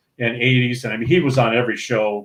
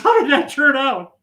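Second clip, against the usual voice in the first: high voiced Brenner; 12 semitones higher than usual. Brenner asking on radio.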